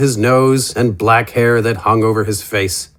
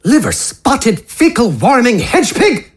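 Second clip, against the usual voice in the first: higher, spluttering theatrical curse; a colourful gentleman cursing a villain; Wither's insult; 11 semitones higher than usual. Acted.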